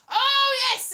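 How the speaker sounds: high, loud "aha" cry; sudden realisation. Retold or imitated. Comic retelling puzzle solved, loud delighted realisation. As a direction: burst out as the penny drops.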